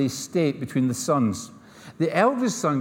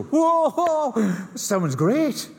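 high, drawn-out mock whoops of awe; imitating thrilled boys; 9 semitones higher, much slower than usual. Twin boys react to a wild testimony, mock excited. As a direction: whoop like an impressed youngster hearing a wild story.

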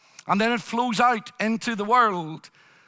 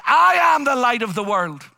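a ringing, loud declaration; loud proclamation; 4 semitones higher, 7 dB louder than usual. Retold or imitated. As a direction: proclaim it loud over the crowd. Jesus proclaims at the festival.

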